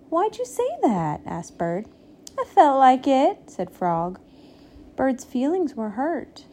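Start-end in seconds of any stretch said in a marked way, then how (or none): 0.1-1.2 high-pitched, wounded question; offended little bird; hurt protest. A narrator's reading. 2.4-3.5 loud, raised, unrepentant comeback; brazen, don't-care answer; defiant retort. A narrator's reading. Frog defiant to Bird.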